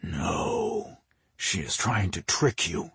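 low, whispery voice in the head; sinister hushed hiss; hissing inner voice. A narrator's reading. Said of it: "a voice hissed in his head".

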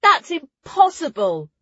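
high, loud and drawn out; astonished disbelief. A narrator's reading. Ruli refusing to believe the record, astonished.

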